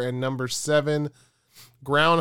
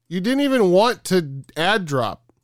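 exasperated; 5 semitones higher, 7 dB louder than usual. Real, not acted. Friend's surprise ad drop.

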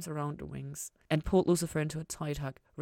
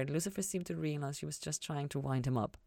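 usual speaking voice

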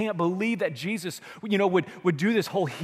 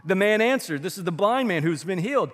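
usual speaking voice

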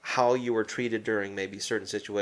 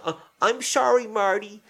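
much higher, exaggerated kid's apology; mock-meaning it, pitched way up like a coerced child; forced sincere apology; 9 semitones higher than usual. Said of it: "say it like you mean it".

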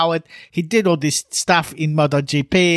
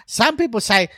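normal talking voice

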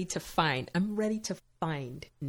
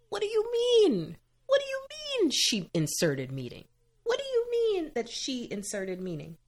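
incredulous; 10 semitones higher than usual. Real, not acted. Indignant at Nick's excuse.

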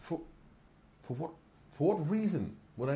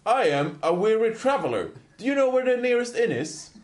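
innocent traveler; 7 semitones higher, 6 dB louder than usual. Acted. Player's character plays innocent traveler.

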